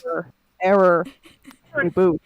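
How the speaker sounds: loud, low robotic error alert; hammed-up robot stuck on an error; malfunctioning machine. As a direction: announce it like a crashing computer. Plays a crashing machine.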